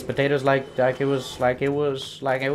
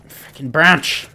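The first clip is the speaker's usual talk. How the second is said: usual speaking voice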